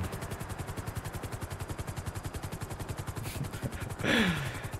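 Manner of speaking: long, soft, breath-only laugh; helpless laughter. Real, not acted. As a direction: crack up almost silently. Cracking up at the misunderstanding.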